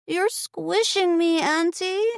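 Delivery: put-on high, slow child's voice; little girl. A narrator's reading. Aurora protesting her aunt's hug, high child voice. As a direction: protest like a squashed kid. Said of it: "Aurora cried".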